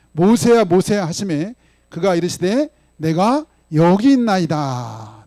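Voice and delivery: slow, loud recitation of the verse; slow, loud congregational recitation; unison scripture reading. Acted. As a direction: read it out together, measured and strong.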